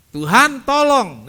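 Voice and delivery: high, loud plea for help; crying out. Retold or imitated. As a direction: call out desperately to the Lord. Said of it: "dia teriak". (berseru keras memohon tolong)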